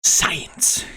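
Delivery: breathy whispered word. Pretend. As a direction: breathe it out in a hush. Whispered science.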